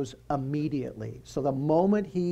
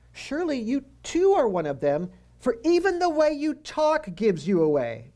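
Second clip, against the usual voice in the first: higher teasing accusation; mocking bystanders; 10 semitones higher, 4 dB louder than usual. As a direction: needle him about his accent.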